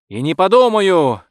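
loud, high exclamation; defiant refusal. A narrator's reading. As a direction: refuse flatly and triumphantly, raising the voice. Gleeful defiant refusal through door.

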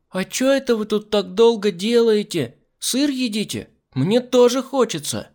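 put-on high, loud nosy little girl; loud child voice. A narrator's reading. Little girl interrupting the adults, loud and high. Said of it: "раздался громкий голос Миранды".

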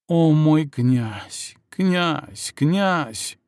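slow, drawn-out moans; passionate moaning. A narrator's reading. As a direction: moan the name in pleasure.

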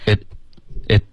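IT cut off with the tongue tip on the palate; relaxed stopped t. Shown as a demonstration. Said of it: "应该是结尾舌尖顶上颚而已". (“It”舌尖顶住上颚，轻松停顿)

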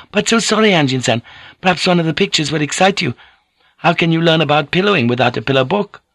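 put-on high, quick, accented woman's voice; innocently persistent lady lover; eager Japanese lady. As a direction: coax your lover briskly, pitched well up. Voices Mariko in the dialogue.

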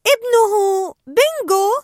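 high, loud, slow sing-song call; Dory calling out. Acted. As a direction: stretch the name out sweetly. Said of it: "ونادت". (نداء دوري العالي البطيء)

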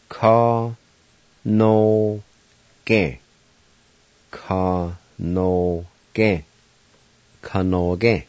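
Ka. No. Ge. said as separate slow syllables; Cherokee syllables. Shown as a demonstration. Teacher sounding out the Cherokee word for wing, syllable by syllable. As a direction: break Ka. No. Ge. apart, one beat each. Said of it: "Word number two, Wing".

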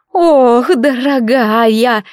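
put-on very drawn-out, tender sighing old woman; gushing granny. A narrator's reading. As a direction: sigh it sweetly, stretching the words like a doting granny. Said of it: "умильно вздохнула какая-то бабулька". (умильный вздох бабульки, нараспев)

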